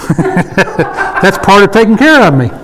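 slow words breaking into real laughter; laughing. Real, not acted. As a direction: answer the teasing while laughing. Laughing at wife-works remark.

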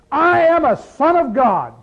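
loud, high and slow, a proud claim; proud declaration. Pretend. Pastor voicing a believer's resume, loud and proud. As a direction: announce it like the top line of a resume. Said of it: "You've got a resume written here".

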